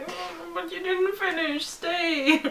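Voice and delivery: high, drawn-out mock whine; slow, high-pitched pretend begging; imitated pleading fans. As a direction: plead like a let-down fan, playfully. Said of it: "we're like".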